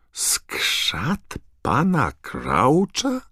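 as if lightning just struck him; thunderstruck. A narrator's reading. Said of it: "takim głosem, jakby grom w niego strzelił".

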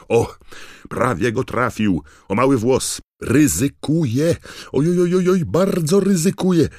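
high, loud cries building to a shriek; frantic arena commentator, pitch climbing as the danger grows; Bagman's excited commentary. A narrator's reading. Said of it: "komentarz Bagmana".

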